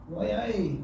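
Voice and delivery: a half-hearted mock Scottish "Why, aye" said softly; a lightly done, quiet Scottish accent on "Why, aye"; bad Scottish accent. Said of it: "That was my bad Scottish accent".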